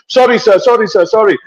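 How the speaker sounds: high, hurried-sounding mock apology; imitated frantic apology. Chai-stand kid apologises to the rider, high imitated fluster.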